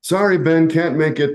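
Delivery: normal talking voice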